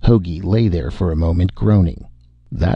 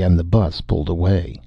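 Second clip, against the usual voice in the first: plain reading voice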